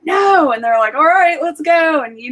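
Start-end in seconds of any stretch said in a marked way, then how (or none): 0.0-0.5 high, loud refusing cry; panicked no. Retold or imitated. Scared jumper to her instructor, high loud refusal. Her answer when asked to jump. 0.9-2.0 high, loud brushing-off call; cheerful go. Retold or imitated. Instructor ignoring the scared jumper, high loud cheer.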